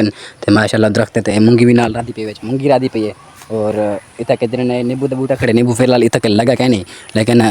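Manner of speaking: ordinary talk, nothing marked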